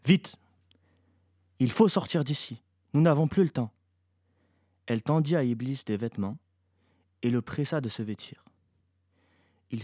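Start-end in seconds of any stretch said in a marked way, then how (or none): 0.1-3.7 raised, pressing urgency; urgent. A narrator's reading. Sheya presses Iblis to leave the hospital, urgently. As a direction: urge them to get out at once. Mother urges son to flee.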